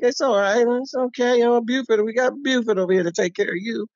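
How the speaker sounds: high, sweet-talking barber reassuring a nervous customer; sugary welcome. Retold or imitated. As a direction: coo at the newcomer, all smiles.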